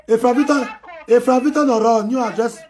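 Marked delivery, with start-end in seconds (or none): 1.1-2.6 loud, high name call. Real, not acted. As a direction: call the name across the room. Calling out fan name.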